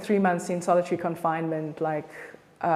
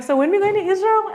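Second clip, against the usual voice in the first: high, lyrical Cape Town lilt; Chris's Cape Town voice; 12 semitones higher, 4 dB louder than usual. Retold or imitated. Friend phones up about a trip, lyrical Cape Town accent. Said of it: "exactly the way he sounds".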